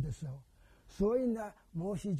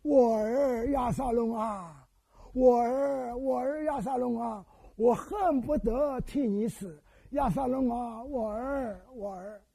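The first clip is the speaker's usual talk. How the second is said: slow, loud weeping lament; king's lament; 3 semitones higher, 6 dB louder, slower than usual. Retold or imitated. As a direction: cry out slowly like a king mourning his son. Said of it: "一面走， 一面哭啊". (大声而缓慢的哀哭)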